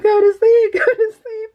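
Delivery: high, loud, laugh-choked go to sleep; imitating laughing daughter. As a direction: mimic the daughter cracking up as she says it. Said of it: "She's laughing from the bedroom".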